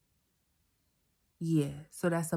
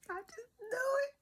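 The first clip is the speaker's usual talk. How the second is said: squealing laugh-cry; 3 dB quieter than usual. Real, not acted. Laughing cry after seeing four.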